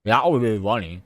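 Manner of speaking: talking while crunching pretzels; eating pretzels. Real, not acted. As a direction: say it through a bite of pretzel. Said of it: "Sorry, I'm eating pretzels".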